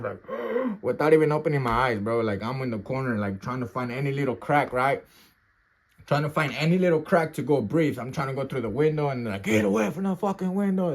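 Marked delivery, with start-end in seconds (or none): 0.3-0.8 high short gasp; snatch air through nose and mouth; imitated breathing gasp. Man recounting hiding his eyes, imitated gasp. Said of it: "through the nostrils and through the mouth". 9.4-10.9 higher-pitched mock angry order; cussing guard. Guard warning inmates off the window, imitated angry order. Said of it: "They start cussing at you".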